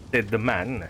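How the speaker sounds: plain reading voice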